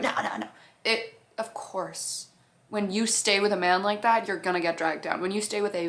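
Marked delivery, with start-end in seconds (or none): 0.0-0.5 a hint of a gruff man saying no; low brush-off. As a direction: lower and softer refusal. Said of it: "he went".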